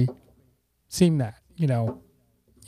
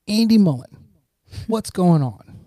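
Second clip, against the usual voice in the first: louder, higher hail from behind; calling out; 6 semitones higher, 3 dB louder than usual. Retold or imitated. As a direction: call a name across the room to catch him. Old classmate calls out from behind.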